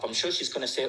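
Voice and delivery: ordinary talk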